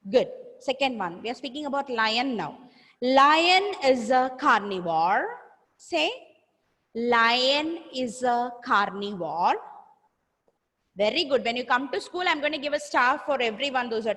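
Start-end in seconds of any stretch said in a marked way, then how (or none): 3.0-5.3 loud, slow modelling with rising end; slow repeat-after-me. Shown as a demonstration. Model sentence for pupils to repeat. 6.9-9.6 very slow, rising teacher modelling; slow repeat-after-me. Shown as a demonstration. Model sentence for pupils to repeat.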